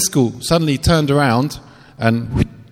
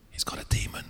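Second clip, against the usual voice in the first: whisper; 13 dB quieter than usual. Retold or imitated. Wife nudges, warns quietly.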